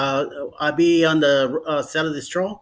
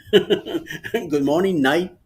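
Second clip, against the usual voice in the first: laughing; much slower than usual. Real, not acted. Mocking a late good morning.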